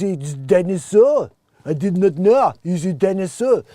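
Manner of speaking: slow, loud mock French accent, drawn out; a Frenchman scolding his dog in a thick French accent. Scolding the dog Skip, in a hammed-up French accent. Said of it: "the French accent".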